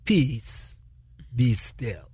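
low, calm, unhurried command. Retold or imitated. Jesus calmly commanding the storm, low and calm. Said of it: "How does he say?".

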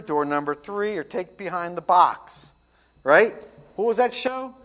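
fervent sermon delivery with big swings; preaching. Acted. Pastor preaching the I am sayings to his flock, rising and falling with fervor. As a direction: preach it to the congregation.

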